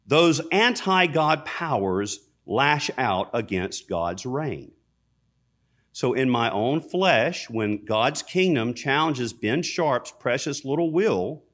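normal talking voice

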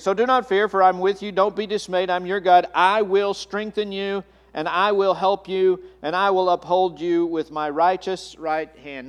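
full-voiced pulpit reading of Isaiah; scripture reading. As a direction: declare the verse over the congregation. Reads Isaiah scripture aloud.

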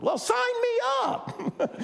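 very high, slow mock enthusiasm; mock-eager disciple, slow and sky-high sarcasm; sarcastic. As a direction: drag it out with heavy sarcasm. Sarcastic reply to imagined disciples.

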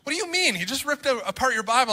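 high-pitched retold telling-off; imitating an indignant teacher; scolding teacher. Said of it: "gave him a whole bunch of heck".

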